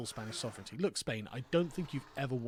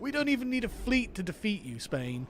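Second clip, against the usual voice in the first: higher, gloating jeer; mock triumphant taunt; 6 semitones higher, 3 dB louder than usual. British gloating over Spain in a mock taunt. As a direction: crow it at Spain like you've won.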